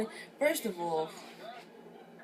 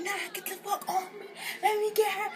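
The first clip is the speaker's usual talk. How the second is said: much higher, laughing-crying mock fury; furious struggle; 12 semitones higher than usual. Host yelling at her cousin to let go, high and frantic. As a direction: yell it while someone holds you back.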